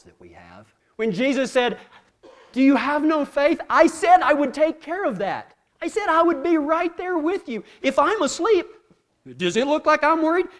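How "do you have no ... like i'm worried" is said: raised, higher-pitched incredulous scolding; exasperated chiding. Acted. Jesus scolding fearful disciples, exasperated. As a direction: chide them as an unworried Jesus would.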